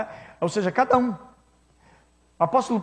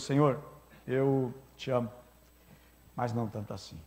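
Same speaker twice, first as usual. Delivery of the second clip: lower, fading Peter, softer to a near-breath; a humbled man barely admitting it; broken Peter; 6 semitones lower, 9 dB quieter than usual. Acted. Peter, broken, answers Jesus in a fading, lower voice. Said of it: "porque ele tá quebrado".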